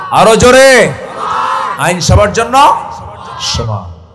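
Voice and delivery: loud, high call to the crowd; rousing shout. Real, not acted. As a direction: urge the audience on at full voice.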